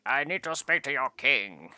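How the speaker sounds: loud, slow, pompous proclamation; trying to be regal. Acted. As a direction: put on a grand royal-envoy voice. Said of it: "total trying to be all regal".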